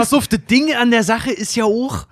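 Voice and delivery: Berlin dialect. Acted. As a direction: push the Berlin twang harder. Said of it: "Das ist schon besser".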